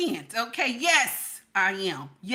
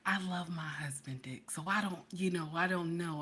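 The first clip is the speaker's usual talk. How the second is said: low, soft near-whisper; hushed confession; 6 semitones lower, 9 dB quieter than usual. Real, not acted. Woman confiding in her viewers about her husband, hushed. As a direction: confide it under your breath.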